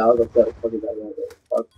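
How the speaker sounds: ordinary talk, nothing marked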